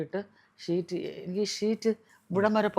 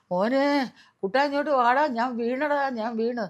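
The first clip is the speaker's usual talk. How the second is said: calling for help; 6 semitones higher, slower than usual. Retold or imitated. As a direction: call out to her son from the floor after falling. Fallen, calling son for help.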